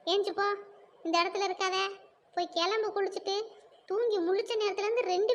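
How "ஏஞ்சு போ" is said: ordinary talk, nothing marked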